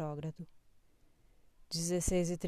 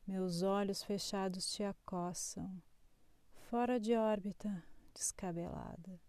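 poetry reading; 3 dB quieter than usual. Acted. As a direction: read it like a spoken poem. Reads a poetic horoscope.

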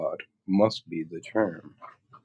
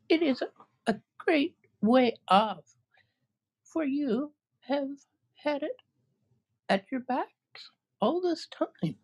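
tiny mouse queen. Acted.

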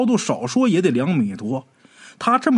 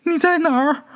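put-on girl asking; 14 semitones higher than usual. A narrator's reading. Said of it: "过了好一会儿才问".

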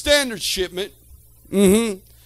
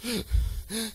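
gasp; 10 dB quieter than usual. Shown as a demonstration. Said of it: "crying so hard you're gasping for your breath".

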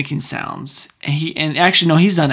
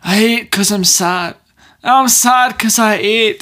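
put-on slow, drawn-out Scottish accent; 5 semitones higher, 3 dB louder, slower than usual. Quoting Fat Bastard, slow Scottish accent. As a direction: stretch it in a mock Scottish brogue. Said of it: "my stereotypical Scotsman".

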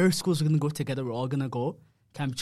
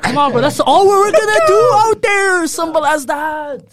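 excited; 14 semitones higher, 14 dB louder than usual. Real, not acted. Hyped mock instruction.

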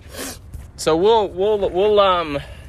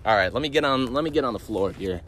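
normal talking voice

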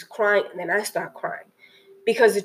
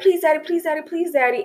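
high, pleading child's voice, light mock; little girl begging; 7 semitones higher, faster than usual. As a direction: imitate a small daughter begging her dad. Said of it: "And she's like".

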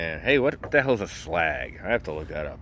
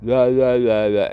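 mock British accent; 5 semitones higher, 6 dB louder, slower than usual. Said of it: "doing a slight accent thing".

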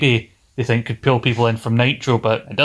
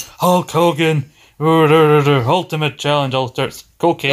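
Warrior impression; 4 semitones higher, much slower than usual. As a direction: stretch every word like a wrestling promo. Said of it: "When I try and do a good Warrior impression".

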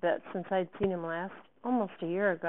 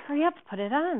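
higher, louder hurrying; urgent; 9 semitones higher, 7 dB louder, faster than usual. Acted. Student handing her friend the dress, urgent. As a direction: press her to move right now.

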